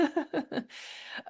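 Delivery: high, soft chuckle; amused laugh. Real, not acted. Host amused at own interruption, soft laugh. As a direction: laugh lightly at yourself.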